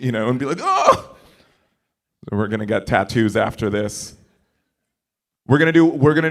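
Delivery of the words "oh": sudden high yelp of delight; mock surprise. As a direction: gasp like you just remembered. Mock realization in the mirror.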